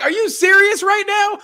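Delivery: mock shocked boyfriend; mock high disbelief. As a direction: squeak it out in disbelief. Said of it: "I'm like".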